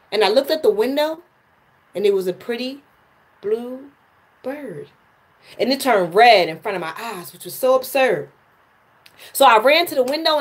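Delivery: spoken-word delivery, measured with pauses; poem recital. Acted. Reciting her poem to livestream viewers, spoken-word style. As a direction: let each line land like a performance poem.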